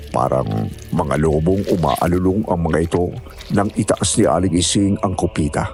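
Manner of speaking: ordinary delivery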